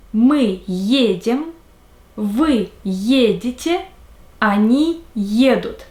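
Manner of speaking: slow, careful conjugation drill; teacher pacing out verb forms; slow drill recitation. Shown as a demonstration. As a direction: recite each form slowly for learners to repeat. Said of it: "the forms of the verbs".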